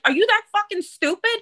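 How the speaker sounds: high, incredulous retold insult; Jersey Jen rage. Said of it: "Jen went into, like, Jersey Jen".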